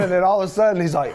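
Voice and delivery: normal talking voice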